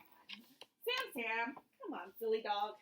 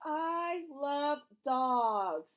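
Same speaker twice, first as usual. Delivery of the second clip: slow reading aloud; much slower than usual. Shown as a demonstration. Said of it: "It says".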